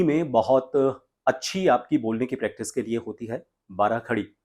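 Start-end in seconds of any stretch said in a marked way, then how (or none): none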